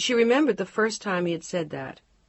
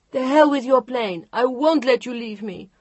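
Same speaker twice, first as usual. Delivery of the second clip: much higher and louder, near a scream; voice rising dangerously; 7 semitones higher, 9 dB louder than usual. Acted. Chantal refusing to let Mark go, voice rising dangerously. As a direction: let the voice climb out of control. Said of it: "Her voice had risen dangerously".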